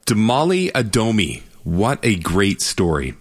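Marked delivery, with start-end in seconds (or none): none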